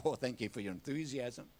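low, soft sarcastic aside with a laugh; dry teasing. Real, not acted. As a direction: wryly thank an unresponsive crowd. Teases a quiet congregation.

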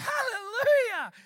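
high, loud, drawn-out praise; jubilant shout. Retold or imitated. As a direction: shout it in triumph to the church. Celebrating the man waking up.

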